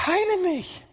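sehr hoch, fast gesungener Hilferuf; flehender Ruf. Acted. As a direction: ruf wie ein Kranker nach Heilung. Prediger spielt Bittende. (sehr hoher, flehender Ruf)

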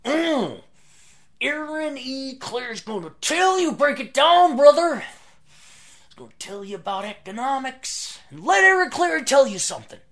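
high, loud wrestling promo shout; Macho Man voice. Retold or imitated. Introducing a guest in wrestler style, high hammed-up promo yell. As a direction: hype the guest like a ring announcer. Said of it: "like Macho Man Randy Savage".

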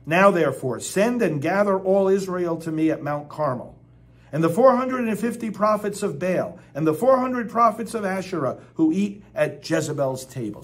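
loud, commanding proclamation; forceful scripture reading. Acted. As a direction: announce it like a summons. Reading Elijah's command aloud.

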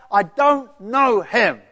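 slow loud furious denial; angry denial. Acted. Peter swears he doesn't know him, slow furious shout. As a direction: drag it out, shouting.

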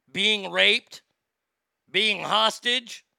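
usual speaking voice

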